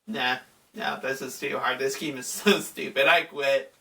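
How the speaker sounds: low, muttered imitation of a frustrated player; dismissive giving up. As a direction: grumble it low, like quitting on a game. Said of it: "I'm like".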